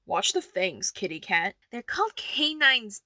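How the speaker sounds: ordinary delivery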